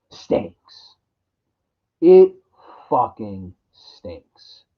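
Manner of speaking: slow, whispered disgust. Real, not acted. Passing judgment on the sequel to viewers, slow disgusted whisper. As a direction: breathe it out like a grim verdict.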